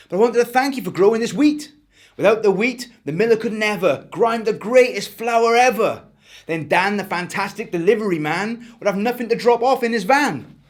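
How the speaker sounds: put-on a boy breathless with his tale; Eric's voice. Eric to Farmer Pete.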